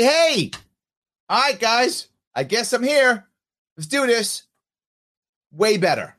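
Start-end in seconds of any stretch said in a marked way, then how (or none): 0.0-4.4 Patterson. Retold or imitated. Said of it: "Just be like Patterson was".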